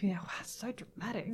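a hushed, teasing aside; sarcastic whisper. Pretend. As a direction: whisper it, dry and mocking. Mocking the dramatic scene.

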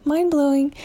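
rising amazement on "mind-blowing"; excited echo. Real, not acted. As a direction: repeat it, thrilled. Repeats the word for emphasis.

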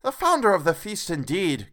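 wife scoffing at the toast; indignant Missus Cratchit. A narrator's reading. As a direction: spit the words back at him. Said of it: "cried Missus Cratchit, reddening".

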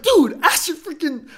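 incredulous outburst at the ref; half-laughing mock outrage; exasperated protest. Indignant players protesting to ref.

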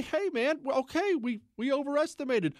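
high-pitched, lightly acted admission; mock concession. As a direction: concede it as an imagined official would. Imagined official grudgingly admitting error.

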